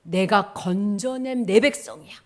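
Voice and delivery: loud, emphatic defence; acted authoritative divine voice; God's declaration. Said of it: "하나님 말씀하시는 거야".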